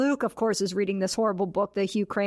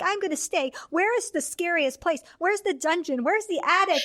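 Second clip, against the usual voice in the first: mock eager wife; 8 semitones higher than usual. Imitating eager skeptical wife Grace.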